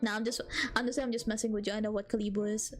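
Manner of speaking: ordinary talk, nothing marked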